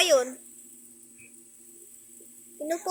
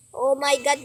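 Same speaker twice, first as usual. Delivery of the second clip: ordinary talk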